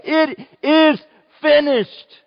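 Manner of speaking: high, loud triumphant shout of It is finished; victory cry. Retold or imitated. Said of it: "this is a victory cry".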